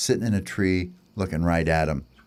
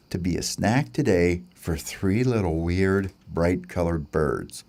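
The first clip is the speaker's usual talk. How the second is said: ordinary delivery, nothing marked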